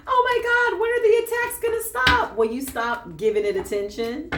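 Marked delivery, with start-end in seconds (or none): 0.1-2.4 very high, loud imitation of a complainer; hammed-up plea, pitched way up and held there; mock desperate wail. Mimics listener complaining about attacks.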